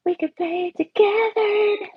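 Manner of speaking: slow, high fake sob; mock crying. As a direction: ham up a teary plea.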